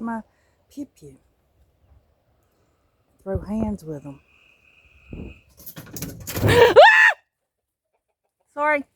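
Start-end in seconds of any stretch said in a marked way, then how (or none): 5.8-7.1 sudden high shriek of fright; startled shriek as a squirrel leaps overhead; startled scream. Real, not acted. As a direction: yelp in real surprise at the squirrel. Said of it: "I scream like a girl".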